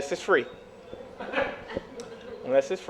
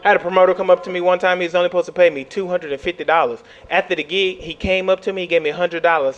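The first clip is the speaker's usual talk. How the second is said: normal talking voice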